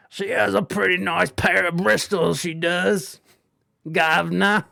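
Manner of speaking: mock British cheeky chap; British accent. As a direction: do a badly faked British accent. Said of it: "do a British accent".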